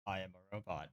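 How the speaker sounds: low quiet robot voice. Pretend. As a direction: speak it like a monotone robot. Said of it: "for robot voices like this".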